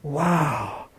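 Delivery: soft, low, drawn-in wonder; awed hush. Acted. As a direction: breathe out a quiet, amazed wow. Acting out awe at scripture.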